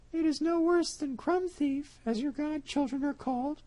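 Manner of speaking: high cat voice, smug; the cat brushing it off; sly cat. Acted. Cat retorts.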